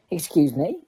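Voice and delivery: low, softly incredulous; hushed, understated disbelief. A narrator's reading. Diggory answers Harry's claim, incredulous. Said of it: "said Mister Diggory incredulously".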